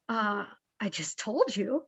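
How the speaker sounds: puzzled. Retold or imitated. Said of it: "they look at me like".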